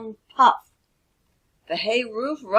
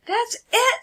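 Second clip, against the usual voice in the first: high excited burst; eureka; 4 dB louder than usual. Acted. Stella hits on the windmill idea, high and excited. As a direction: suddenly get the idea. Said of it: "said Stella".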